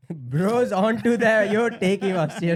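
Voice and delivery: loud, slow words through a burst of laughter; cracking up at the friend's Parle G answer; laughing. Real, not acted. Laughing loudly as the friend picks Parle G.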